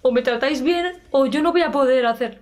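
high, whiny mock complaint; a hint of a spoiled whiner pleading; whiny demand. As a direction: mimic a whiny ultimatum.

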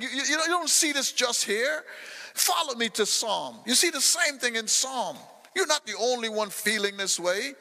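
ordinary talk

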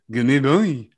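a light mock French accent; lightly put-on French accent, said flat. A French person calling a dog in a light mock French accent. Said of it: "some French person".

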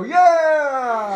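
loud rowdy group cheer; crowd cheer. Acted. Scruffy crew cheers.

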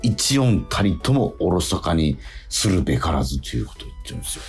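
ordinary talk, nothing marked

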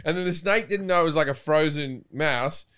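normal talking voice

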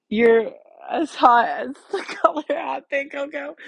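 giggling through a silly line; laughing. Shown as a demonstration. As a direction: laugh while reading the poster idea. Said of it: "I like the color hot pink".

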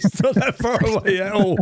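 high-pitched, breaking up with laughter; amused protest through laughter; real laughing. Real, not acted. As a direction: tease through helpless giggles. Laughing at friend's nearby planet.

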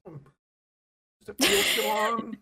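a coy, drawn-out mock question; mock sultry musing. Voicing woman with banana.

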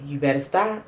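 soft, low mock-gentle warning; a quiet mother's threat, held back; mother hushing a child onstage, gently stern. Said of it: "Mama did the gentle".

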